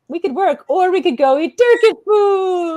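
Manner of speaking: mock-excited temptation; tempted daydream. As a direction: pitch the Turkish-food escape gleefully. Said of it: "we'd always be like".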